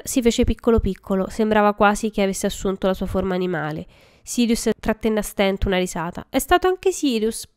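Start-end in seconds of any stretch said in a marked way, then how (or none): 6.3-7.5 high, whiny plea; whining. A narrator's reading. Peter blaming Sirius after being caught, high whine. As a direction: whimper the blame onto a friend. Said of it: "piagnucolò".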